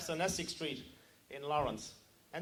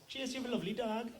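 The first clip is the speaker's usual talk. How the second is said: put-on higher, lilting Cork accent; 4 semitones higher than usual. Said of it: "That's the way they talk".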